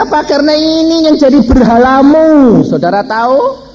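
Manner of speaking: loud, high, insistent sermon delivery; full-force preaching. Real, not acted. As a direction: drive the conviction home at the top of your voice.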